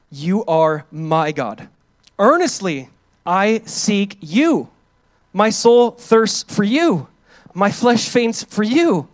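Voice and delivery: each phrase drawn out slowly; slow emphatic reading. Acted. Preacher reading the psalm slowly, drawing out each phrase. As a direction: lean into every word, slow. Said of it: "you need to see the emphasis".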